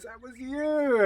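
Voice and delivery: high, shocked "That was you.". Retold or imitated. Stunned woman realising she met him before. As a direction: gasp it out in disbelief. Surprised recognition in retold story.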